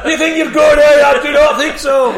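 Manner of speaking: high, very loud hammed-up dad; shouted mock taunt. Dad pelting his son with balloons, mock-shouted taunt. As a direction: yell it as a triumphant retold taunt.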